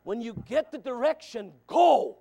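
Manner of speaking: preaching it again in full force; loud restart. Shown as a demonstration. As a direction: hurl the go out at them. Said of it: "restarts after I'm winded".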